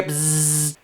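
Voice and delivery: soft sustained buzzing noise; imitated machine buzz. Shown as a demonstration. Showing the headstock going through the machine, soft imitated buzz. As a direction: buzz quietly like a running sander.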